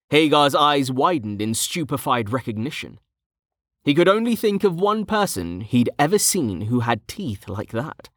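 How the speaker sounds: usual speaking voice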